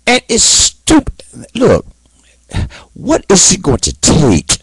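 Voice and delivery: high, strained angry outburst; furious preaching at the audience; angry rant. Real, not acted. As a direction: really let the anger boil over. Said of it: "and I am angry".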